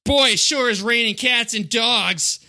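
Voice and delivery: high, slow put-on swagger voice; quoting Jack's disguise. Podcaster quoting Jack in disguise at the brothel, high slow swagger. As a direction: play the cheesy undercover hero, slowly.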